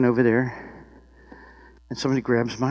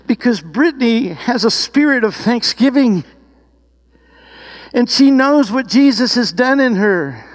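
raised, emphatic preaching voice; impassioned sermon exhortation; 5 semitones higher, 5 dB louder than usual. Real, not acted. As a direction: drive the message home to the congregation, punching key words. Preaches the point with rising force.

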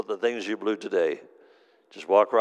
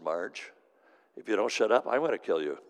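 usual speaking voice

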